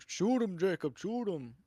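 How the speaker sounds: hammed-up Cajun accent impression. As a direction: yell it like a Cajun gator hunter on the bayou. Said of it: "the Cajun swamp people".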